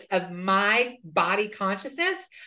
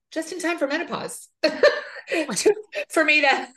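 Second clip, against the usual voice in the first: laughing it out, pitch up high; 7 semitones higher than usual. Real, not acted. Joking to the host about menopause, laughing. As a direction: laughs at the bad timing while still talking.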